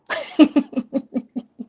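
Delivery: high giggle in a quick run. Real, not acted. Streamer amused by a joking follower, giggling. Laughing at Benita's jokes.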